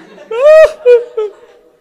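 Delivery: high, loud burst of laughter. Real, not acted. Preacher cracking up at his own imagined crying principal, laughing. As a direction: burst out laughing after the mock wail.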